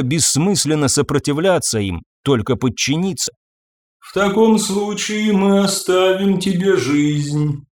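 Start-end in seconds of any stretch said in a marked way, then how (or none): 4.0-7.6 high, slow, serene otherworldly voice; calm and untouchable, ringing. Acted. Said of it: "безмятежно произнесла женщина".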